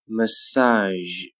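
"Massage" with long vowel and final zh; slow careful "Massage"; drawn-out model. Shown as a demonstration. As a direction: stretch "Massage" out for learners. Teacher models word slowly, then normally.

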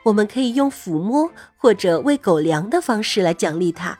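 ordinary delivery, nothing marked

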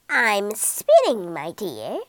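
put-on croaking old woman. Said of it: "croaked the old woman".

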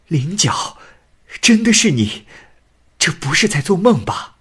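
can this be a dream, cry it out; joyful disbelief. A narrator's reading. Reunited husband, amazed joy.

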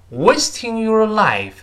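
"Wasting your life" drawn out, high, with "Wasting" and "life" punched; key-word stress. Shown as a demonstration. Teacher isolates the stressed words, "Wasting your life" slow and emphatic. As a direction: lean slowly on "Wasting" and "life", falling at the end. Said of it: "一定是重读的".

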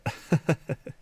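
soft, high little chuckle; short rippling laugh, kept light. Acted. Aunt agreeing with the praise, chuckling lightly.

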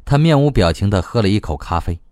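usual speaking voice

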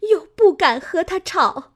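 high, loud, weepy telling of the plot; a sobbing wife pleading her case; tearful complaint. A narrator's reading. Fengjie tells the matriarch of the plot, high and tearful. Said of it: "凤姐儿哭道".

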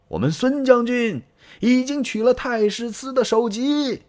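high, carrying shout across to the enemy; shouting at the gate. Acted. Soldiers yelling at Liu Yao's camp gate, high carrying shout. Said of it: "冲着营门喊呐".

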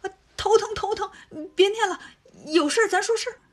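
high, pained cry of a headache; acted pain. Monkey King begs his master to stop the spell, in pain. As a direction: clutch your head and plead. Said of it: "孙悟空捂着脑袋".

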